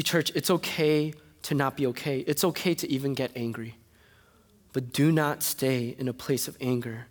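ordinary delivery

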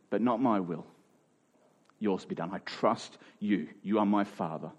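soft, hushed prayer of surrender. Acted. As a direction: pray it quietly, giving in to the Father. Jesus praying in the garden.

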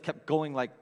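ordinary talk

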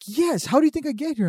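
high, exasperated imitation; mock disbelief at a stupid question; indignant coworker. Retold coworker indignant at prank.

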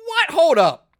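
high, loud, startled double take; stunned whoa. Retold or imitated. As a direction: stop the story in disbelief. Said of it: "So I'm like".

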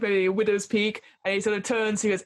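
ordinary talk, nothing marked

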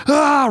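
high mock roar "Ah"; imitated hurricane roar. Comic retelling of Hurricane Harvey hitting Texas, imitated roar. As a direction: roar like a storm crashing in.

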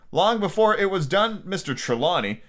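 ordinary delivery, nothing marked

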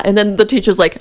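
normal talking voice

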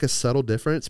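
ordinary talk, nothing marked

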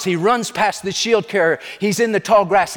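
ordinary talk

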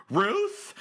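high, questioning call across a field; hopeful call. Pretend. Separated lover spots partner across the field, hopeful call. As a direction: call out, unsure it's really her. Movie lovers find each other.

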